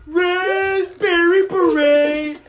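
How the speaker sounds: screamed song title. Retold or imitated. Pranking his brother at the bathroom door, a high loud slow scream. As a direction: yell it in a stranger's face, eyes rolled up. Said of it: "and I just screamed".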